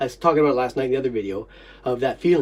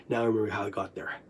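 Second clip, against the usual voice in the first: normal talking voice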